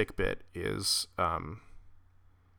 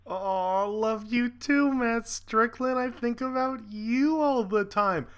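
much higher, slow and syrupy mock tenderness; mock sweet reply; 12 semitones higher, slower than usual. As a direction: coo it back to tease him. Mocking the caller's sign-off.

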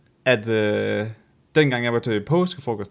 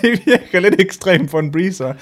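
slow, higher, laughing verdict; amused disbelief; 6 semitones higher, 5 dB louder, slower than usual. Real, not acted. Judging the chokehold over a breezer, slow amused. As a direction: draw it out, incredulous.

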